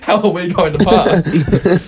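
high, drawn-out retold worry, laughing; laughing mock dismay. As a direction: imitate worried onlookers while cracking up. Retells onlookers' worry while laughing.

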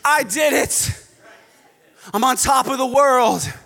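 high, loud hammed-up boasting; mock triumph. Preacher imitating someone who boasts of saving himself, triumphant. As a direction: crow like a self-made champion.

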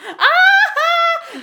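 high loud mock shriek; mock scream. Retelling Erwin screaming, mock shriek. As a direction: scream like a scared friend.

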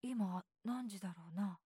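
put-on low, quiet older-brother voice; a drowsy question from bed; low sleepy brother. A narrator's reading. Older brother in bed asks his little brother, low and quiet.

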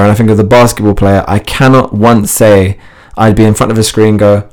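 ordinary delivery

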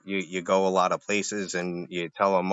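normal talking voice

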